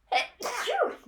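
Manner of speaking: sudden high sneeze. Real, not acted. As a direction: let out a sharp sneeze. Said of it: "Oh, sorry, I'm gonna sneeze".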